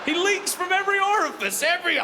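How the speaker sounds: high, loud exasperation; exasperated outburst. Acted. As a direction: yell it in disbelief. Exasperated about son.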